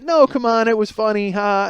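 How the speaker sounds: high, loud imitation of a wife laughing it off; mock cajoling wife. Wife brushes off husband's complaint, mock cajoling. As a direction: coax with a high, forced "ha".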